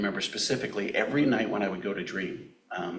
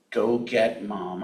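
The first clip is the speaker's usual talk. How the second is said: slow, louder, dragged-out plea; boy on the floor begging his friend, slow and louder; 5 dB louder, much slower than usual. Retold or imitated. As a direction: push each word out slowly. Dying boy begs his friend.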